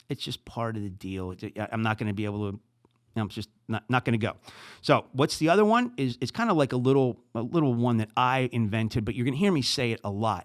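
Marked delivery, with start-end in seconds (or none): none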